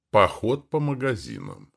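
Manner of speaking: usual speaking voice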